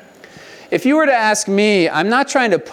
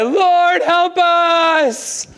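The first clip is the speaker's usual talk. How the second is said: crying out; 11 semitones higher, much slower than usual. Retold or imitated. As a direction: call for help, desperate. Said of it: "They cried to the Lord".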